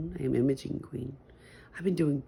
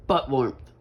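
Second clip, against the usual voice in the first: ordinary talk, nothing marked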